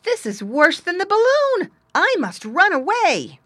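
very high, loud panicking piglet; high-pitched storybook alarm; frightened cry. A narrator's reading. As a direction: cry out, desperate to flee. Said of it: "cried Squinty".

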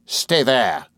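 restrained angry command; Rosa Dartle, through set teeth. A narrator's reading. Rosa blocking the door, mildly fierce. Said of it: "through her set teeth".

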